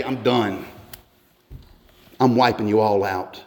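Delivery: low, restrained verdict; God passing judgment. Acted.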